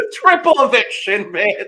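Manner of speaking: loud, high, gleeful holler; mock announcement. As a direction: belt it out through laughter. Pretend host announcing shock twist.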